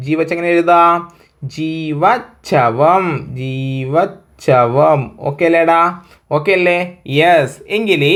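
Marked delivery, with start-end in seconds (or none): 1.6-5.0 ജീവച്ഛവം pulled out very slowly, falling at the end; teacher spelling out the answer; slow drill. Shown as a demonstration. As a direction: dictate ജീവച്ഛവം syllable by syllable. Teacher drills the joined word.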